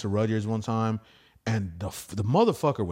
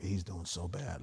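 hushed aside; 5 semitones lower, 12 dB quieter than usual. Retold or imitated. Whispering to the bartender.

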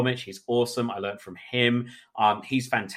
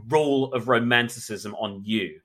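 usual speaking voice

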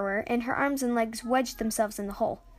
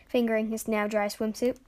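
plain reading voice

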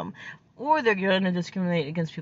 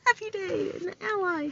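mock cheer; 12 semitones higher than usual. Mock celebrating new ally.

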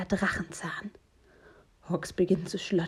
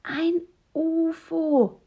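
high, loud, stretched-out insistence; small child digging in, word by word; high-pitched stubborn kiddie voice; insistent little one; 10 semitones higher, 5 dB louder, much slower than usual. A narrator's reading. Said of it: "beharrt der Kleine".

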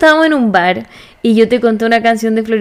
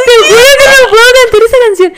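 muy aguda y atropellada, casi llorando; voz llorosa; 10 semitones higher, 4 dB louder, faster than usual. Real, not acted. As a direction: suplicar entre risa y llanto. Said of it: "La voy a cantar". (no poder cantar de la emoción)

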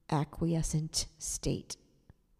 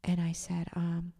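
normal talking voice

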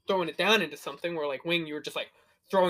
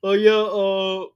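loud, slow, higher anime-hero drone; mock Bleach character; 4 semitones higher, 8 dB louder, much slower than usual. Player teases friend's Bleach character, slow loud mock voice. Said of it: "You're just gonna make a Bleach character".

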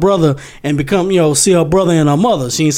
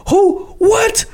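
high, crying-out panicked "What?"; shocked outcry; much slower than usual. Retold or imitated. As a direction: yelp out in panicked disbelief. Everybody reacting in shock.

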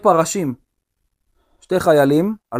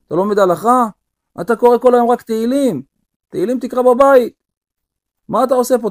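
angry rich man; 6 semitones higher than usual. Acted. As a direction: berate the poor man and throw him out. Rich man berates poor guest. (עשיר כועס וגוער בעני)

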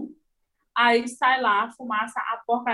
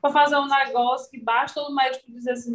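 ordinary delivery

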